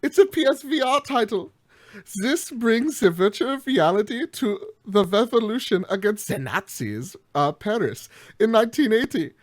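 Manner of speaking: hammed-up German accent. Host pitching the game in a hammed-up German accent. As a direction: play up a cartoon German accent. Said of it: "we're gonna do the accent".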